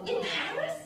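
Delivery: high, loud disbelieving question; can't believe what she heard; surprised. Retold or imitated. Astonished reply to auntie.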